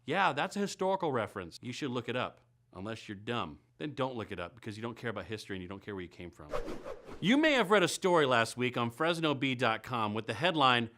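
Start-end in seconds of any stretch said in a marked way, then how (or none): 1.6-6.5 snide mutter. Acted. Insulting aside trailing off.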